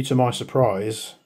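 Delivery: ordinary talk, nothing marked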